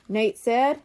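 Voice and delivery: ordinary delivery, nothing marked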